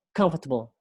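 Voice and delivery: "comfortable" leaning on the COM; first-syllable stress. Shown as a demonstration. Teacher modelling word stress, "comfortable" stressed at the start. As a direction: hit "com" in "comfortable", then let the rest fall. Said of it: "lebih, lebih ke calm".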